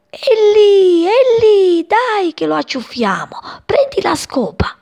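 put-on high, loud kids spotting the bird; excited shouting. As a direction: urge the others to catch it. Children chase the bird.

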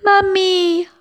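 high, loud frightened chick calling mummy; high desperate cry for help. Acted. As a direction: scream for mother while falling. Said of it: "gridò con tutta la voce".

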